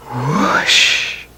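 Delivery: whooshing wind. A narrator's reading. Wind sound effect in story.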